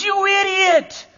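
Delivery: high, slow, outraged yell; exasperated cry of "idiot" at a fumbled catch; mock outrage at the game; wife yelling at the TV. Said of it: "my wife goes".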